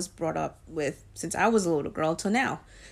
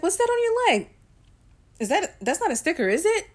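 mom's question jumps way higher and louder; mother's alarmed surprise; 10 semitones higher, 3 dB louder than usual. Retold or imitated. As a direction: play the shocked mother spotting a tattoo. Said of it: "she's like".

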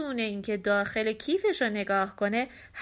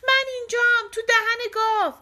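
high, loud shout; Tom shouting; 12 semitones higher, 6 dB louder than usual. A narrator's reading. Tom shouting to mother from the cow's mouth, high and loud. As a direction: shout to be found. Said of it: "تام فریاد زد".

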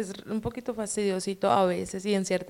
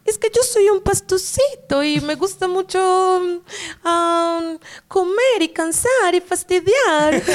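mocking Pasto accent, higher and louder; 9 semitones higher, 4 dB louder, slower than usual. Shown as a demonstration. Guest mocking the Pasto accent she dislikes, higher mock Pasto voice. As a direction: lay on a teasing Pasto lilt, slowing at the end. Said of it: "que es el pastucito, que es como".

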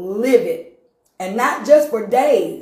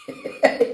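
big high laugh. Real, not acted. Laughing hard while telling the church story. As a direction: crack up at the memory.

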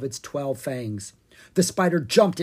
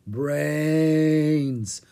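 long drawn-out moaning zombie; zombie groan; 4 dB louder than usual. A narrator's reading. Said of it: "Ella groaned".

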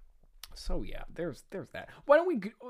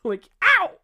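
high, loud comic "ow"; hammed-up yelp of pain; yell in pain; 11 dB louder than usual. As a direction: yelp as if just punched. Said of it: "Shocker yells in pain".

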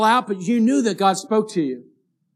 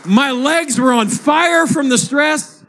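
high, loud, shouting preacher's climax; preaching at full force; 7 semitones higher, 3 dB louder than usual. Acted. Pastor shouts the story's climax to his church, full-force preaching. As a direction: whip the congregation up with the story.

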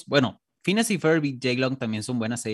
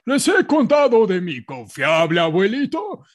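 put-on old man voice; 6 semitones higher, 6 dB louder than usual.

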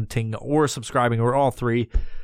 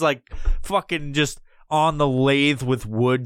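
ordinary talk

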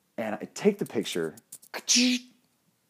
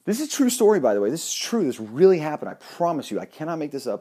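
higher and louder, swearing it's true; emphatic insistence; 6 semitones higher, 7 dB louder than usual. Real, not acted. To the audience, vowing the story really happened, excited and loud. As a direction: make them believe you, loudly.

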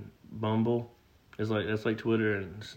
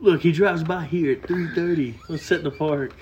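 mock eager; 5 semitones higher, 6 dB louder than usual. Mocking friend's fan plan.

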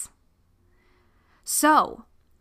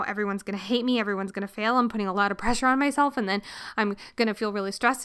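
panicked rush; much faster than usual. Retold or imitated. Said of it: "The stress of, like".

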